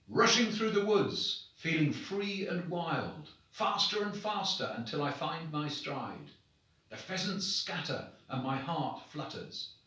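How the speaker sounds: plain reading voice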